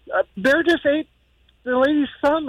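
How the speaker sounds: high, mock-distressed voice, no real sobs; upset daughter. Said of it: "She calls me crying".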